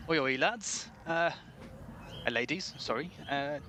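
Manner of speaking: kindly West Country shopkeeper; apologetic local shopkeeper with a West Country lilt; West Country accent. Acted. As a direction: put on a friendly West Country voice. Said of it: "best West Country accent this is".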